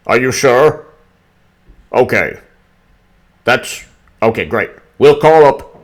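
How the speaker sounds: loud, excited put-on voice of a scheming official; mock Brennan phone call. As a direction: ham up a frantic spy boss on the phone. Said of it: "Brennan's like".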